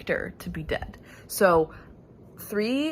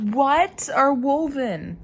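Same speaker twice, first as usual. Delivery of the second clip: slow, louder, higher baffled ask; strange drawn-out question; 5 semitones higher, 6 dB louder, slower than usual. Real, not acted. Asking herself mid-book, loud drawn-out question. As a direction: stretch the puzzled question out. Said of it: "why did I say it like that".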